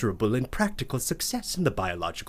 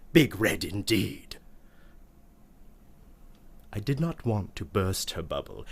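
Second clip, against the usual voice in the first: ordinary delivery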